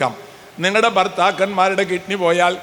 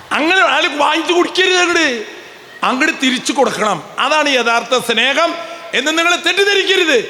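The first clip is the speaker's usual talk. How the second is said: emphatic warning; 7 semitones higher than usual. Real, not acted. Priest warning the congregation, shouting. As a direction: drive the warning home to the pews.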